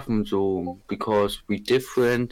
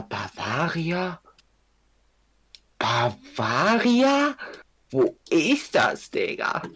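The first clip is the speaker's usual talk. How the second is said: drawn-out baffled; 7 semitones higher, slower than usual. Real, not acted. Baffled at map label.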